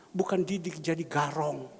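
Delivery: ordinary talk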